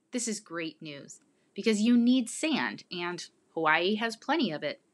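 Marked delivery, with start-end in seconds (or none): none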